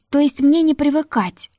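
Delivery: a hammed-up woeful sigh; mock sorrowful sigh. Heroine faking grief to fool Ruta, a mock woeful sigh. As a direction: overplay the sorrow on purpose. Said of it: "нарочито горестно вздохнула я".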